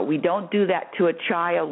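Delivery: ordinary delivery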